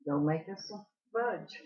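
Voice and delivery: normal talking voice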